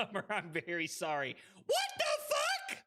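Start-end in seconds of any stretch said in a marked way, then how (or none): 1.5-2.8 very high, incredulous yell; alarmed shriek. Real, not acted. Streamer thinks they're gonna die, high alarmed shriek. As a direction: screech it in disbelief.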